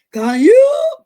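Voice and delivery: high, loud exasperated shout; sudden shrieked demand at a child; voice going up. Retold or imitated. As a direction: let it shoot up into a parent's yell. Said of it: "And then the voice goes up".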